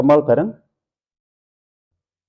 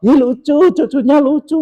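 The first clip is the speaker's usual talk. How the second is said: much higher, louder doting gush; cooing over a grandchild; 7 dB louder than usual. Retold or imitated. Grandpa gushing while the child cries, high doting coo. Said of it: "jangan terus gini".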